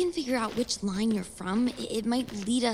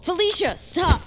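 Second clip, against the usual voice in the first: high, louder cry to stop her; sharp shout; 9 semitones higher, 5 dB louder than usual. Acted. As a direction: cut her off hard. Argument peaks, she cuts in.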